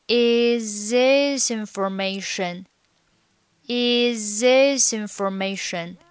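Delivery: "Is this information" drawn out as "i-zi-sinformation"; slow linking. Shown as a demonstration. Teacher drilling linking, "Is this information" slowly joined. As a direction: stretch "Is this information", each word sliding into the next. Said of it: "构成一个连读".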